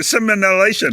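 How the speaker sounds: simulation with an extra syllable, "sim-en-uh-lation"; mispronounced. Real, not acted. Co-host offers the word, garbling simulation as "simenalation". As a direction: stumble simulation into five syllables, adding an n. Word fumbled, then said properly.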